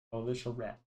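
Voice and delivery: usual speaking voice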